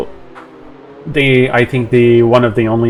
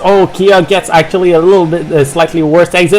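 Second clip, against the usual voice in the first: excited motorsport commentator; 8 semitones higher than usual. Real, not acted. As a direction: call the battle at full excitement. Live race play-by-play.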